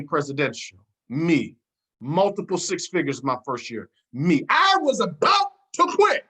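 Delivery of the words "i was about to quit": exasperated. Retold or imitated. Retelling his own frustration.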